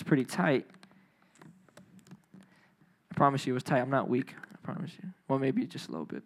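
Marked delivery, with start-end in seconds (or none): 4.5-6.2 whispered aside. Real, not acted. Joking aside about tight cap.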